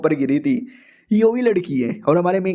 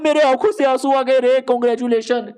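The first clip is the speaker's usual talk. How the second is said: hammed-up, high-pitched tearful congratulations; overacting; 6 semitones higher than usual. A narrator's reading. Bear congratulating the senior, overacting with mock-tearful emotion. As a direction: gush as if moved to tears, laying it on thick. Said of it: "अरे भालू, over acting नहीं".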